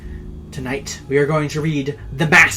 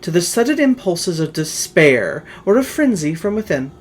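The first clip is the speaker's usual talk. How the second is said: louder, theatrical reading of a gothic tale; dramatic story narration; 3 semitones higher, 4 dB louder than usual. A narrator's reading. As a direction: tell it like a ghost story by candlelight. Reads the Poe tale aloud.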